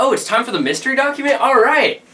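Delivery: high, loud burst of enthusiasm; excited announcement. Pretend. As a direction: pipe up loudly, raring to go. Mock surprise at the task.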